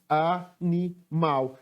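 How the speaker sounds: "animal" slowly in three separate syllables; separando sílabas. Shown as a demonstration. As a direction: break "animal" into even beats with small pauses. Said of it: "Sílaba que eu pronunciei com mais força". ("animal" pausado, sílaba por sílaba)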